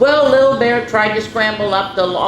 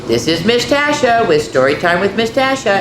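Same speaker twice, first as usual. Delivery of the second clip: ordinary delivery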